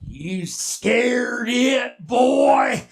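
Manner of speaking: very drawn-out monster taunt; taunting bushman. Acted. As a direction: stretch each word, booming on boy. Monster taunts the boy again.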